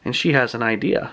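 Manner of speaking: usual speaking voice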